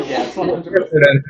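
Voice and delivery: laughing loud admission. Real, not acted. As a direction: laugh through the confession. Admitting prank while laughing.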